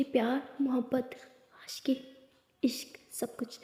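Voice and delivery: soft, fading romantic murmur; intimate whisper. Acted. Lily confessing love to Lakshmi alone in the washroom, soft fading whisper. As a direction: breathe each word of love softly.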